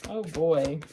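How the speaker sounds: ordinary delivery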